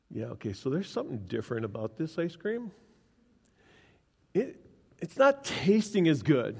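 very soft, tentative musing; hushed puzzlement. Retold or imitated. Son privately doubting mom's ice cream, quietly puzzled. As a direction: say it under your breath, doubtfully. His puzzled thought while eating.

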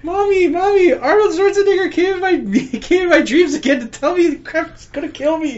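put-on high whiny scared kid; whiny child. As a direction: whimper it to mommy, high. Kid crying to mommy.

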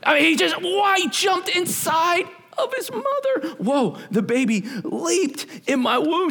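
high, slow, wide-eyed amazement; excited. Retold or imitated. Preacher telling his wife about the John the Baptist meme, amazed and high. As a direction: marvel at the leaping baby. Retells the leaping baby excitedly.

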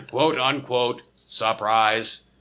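slow, flat robot speech. Pretend. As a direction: drone it out like a robot. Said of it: "robot voice".

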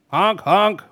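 high double honk in an animal voice; rhinoceros honking. Acted. Otto stamps about wild with hunger, loud honking. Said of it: "brummede Otto og trampede".

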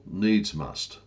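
ordinary delivery

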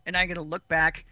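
ordinary talk, nothing marked

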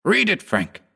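high-pitched urgent command. A narrator's reading. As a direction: order him in a high, insistent voice. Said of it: "he commanded".

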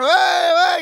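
high, loud mock stadium cheer; crowd cheer. Preacher retelling a soccer crowd cheering, shouted high. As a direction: imitate fans yelling at a goal. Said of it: "todo el mundo empieza a gritar".

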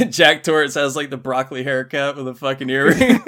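ordinary talk

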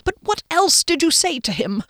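high, anxious probing; worried question. A narrator's reading. Friend wringing his hands, worried question. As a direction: press for the answer, fretting. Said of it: "He wrung his hands".

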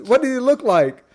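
high mock questioning; imitated judge. Judge asking Fletcher about the attacker, imitated question. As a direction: ask it well above normal pitch. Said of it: "He says".

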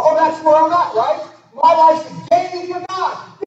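high, loud indignant mock voice; mock outraged reader. Preacher voicing an unbeliever's scoff, high and loud. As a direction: play the scoffing unbeliever, slowing at the end. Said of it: "I read this and I go".

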